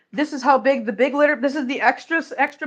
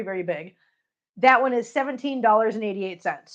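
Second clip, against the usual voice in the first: normal talking voice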